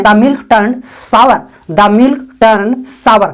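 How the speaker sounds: very slow, word by word; slow, clear dictation; slow model sentence. Shown as a demonstration. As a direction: spell it out slowly for learners. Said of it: "तो आप बोल सकते हैं".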